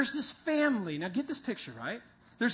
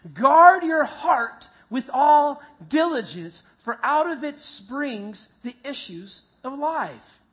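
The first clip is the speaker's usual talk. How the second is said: slow, much louder start, emphatic; louder reading again; 3 semitones higher, 8 dB louder, slower than usual. Shown as a demonstration. Pastor urging the congregation to read it louder again. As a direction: push the verse out with more conviction. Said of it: "Try one more time".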